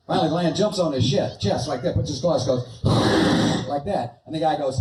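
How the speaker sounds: normal talking voice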